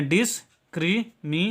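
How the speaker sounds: Discrimination broken into dis, kri, mi, ne, san; syllable by syllable. Shown as a demonstration. Teacher showing learners how to read a long word, discrimination split syllable by syllable. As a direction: say discrimination in separate parts with pauses. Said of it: "इसको पढ़ेंगे".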